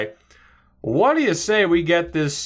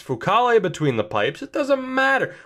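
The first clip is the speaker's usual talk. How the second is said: normal talking voice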